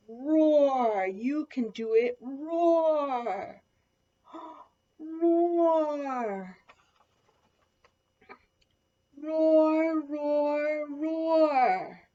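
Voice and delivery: big roaring. A narrator's reading. Mouse helps Little Lion learn, loud long roars. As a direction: gasp for breath and roar it out.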